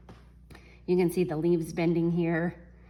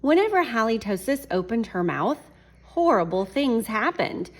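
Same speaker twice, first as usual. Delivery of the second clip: story reading; 3 semitones higher, 6 dB louder than usual. A narrator's reading. Reads picture book to class.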